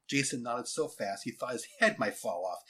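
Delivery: ordinary delivery, nothing marked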